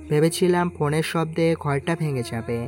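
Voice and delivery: usual speaking voice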